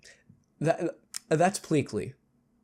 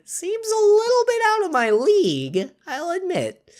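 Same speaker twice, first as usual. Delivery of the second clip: mock high voice; 14 semitones higher than usual. Mock voicing game text.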